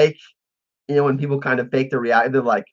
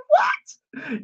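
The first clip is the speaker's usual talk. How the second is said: very high squeaked disbelief; incredulous; 3 dB quieter than usual. Real, not acted. Streamer reacting to fan's offer of money, incredulous. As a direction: let it leap up in disbelief.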